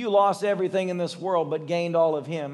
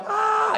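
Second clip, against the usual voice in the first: much higher gasp of wonder; a high sudden ah; startled gasp. Retold or imitated. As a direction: gasp as it hits. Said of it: "they're like".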